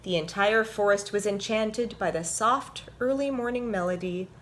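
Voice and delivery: animated picture-book read-aloud; reading to children. A narrator's reading. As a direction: read it like story time for little ones.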